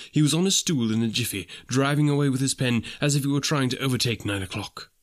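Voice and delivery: ordinary delivery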